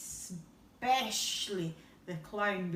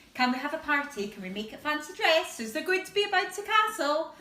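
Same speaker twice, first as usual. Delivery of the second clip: higher, louder and speeding up; tumbling excited questions; 5 semitones higher, 7 dB louder, faster than usual. Acted. Gideon pestering about his party, eager and speeding up. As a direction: rattle off the questions faster and faster.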